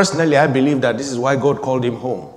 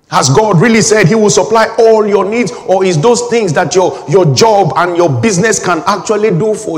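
loud, higher preaching build-up; full-force preaching; 6 semitones higher, 6 dB louder than usual. Acted. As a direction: hammer the congregation with the question.

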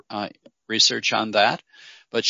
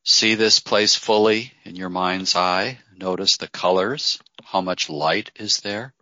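guided meditation. Acted. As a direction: ease listeners into calm, phrase by phrase. Leads a guided visualization.

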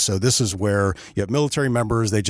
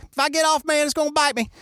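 put-on hillbilly accent; faster than usual. Skeeter on the alligator asking for duct tape, hillbilly accent. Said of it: "I'm just gonna use an accent".

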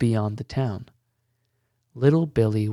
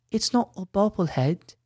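put-on small child voice; 8 semitones higher than usual. A narrator's reading. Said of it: "A small voice called after him".

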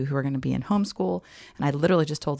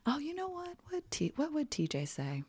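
mock pondering; 11 semitones higher, 10 dB quieter than usual.

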